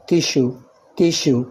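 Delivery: تيشو said tishoo with sh; sh in tissue. Shown as a demonstration. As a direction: say تيشو with sh in the middle. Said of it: "فهتنطق إيه؟ شين".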